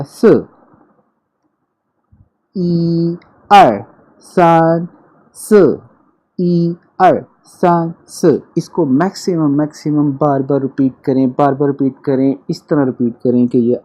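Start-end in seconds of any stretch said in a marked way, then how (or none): 2.6-8.4 "स" said with a jerk, falling sharply, "ई" and "सान" high and level; Mandarin tones. Shown as a demonstration. Teacher counting to four, jerky fourth tone. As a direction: jerk "स" down hard at the end. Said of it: "ये भी झटके से बोला जाएगा".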